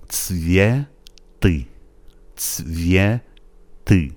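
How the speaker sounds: "تسویتی" very slowly as tsvye, pause, ty; syllable-by-syllable demo. Shown as a demonstration. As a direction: stretch "تسویتی" in two parts with a clear ts start. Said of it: "اگه این تس رو درست نتونین تلفظ کنین".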